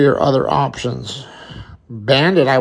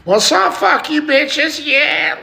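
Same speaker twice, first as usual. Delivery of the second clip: high-pitched, hyped-up mock swagger; cocky mock greeting; 10 semitones higher, 4 dB louder, slower than usual. Streamer taunting the live viewers, cocky mock greeting. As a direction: throw it out high and drawn out.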